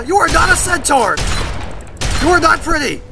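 shrieking disgust at the creature; horrified shout. Real, not acted. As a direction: scream it at the monster in disbelief. Shocked by monster in game.

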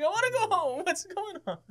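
high, hammed-up plea; mock distraught. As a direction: whine it high like comic distress. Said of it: "completely distraught".

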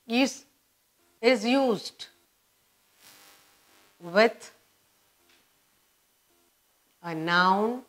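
halting dictation with long pauses between phrases; word-by-word dictation. Shown as a demonstration. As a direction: dictate it piece by piece for note-takers.

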